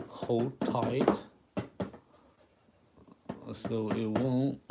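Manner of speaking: ordinary talk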